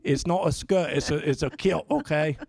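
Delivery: put-on lightly done Scottish accent; a hint of a Scottish accent; bad Scottish accent. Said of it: "that was bad Scottish accent".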